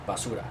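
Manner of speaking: "Basura" said the Spanish way, soft and quiet with a rising end; quiet Spanish "Basura" as a throwaway put-down; Spanish word. Shown as a demonstration. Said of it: "as we say".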